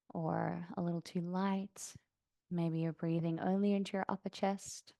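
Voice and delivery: calm yoga-teacher meditation voice, long pauses; soft, settled breathing-practice guidance; guided meditation. Real, not acted. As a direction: guide them gently into stillness, unhurried. Leads seated breathing meditation.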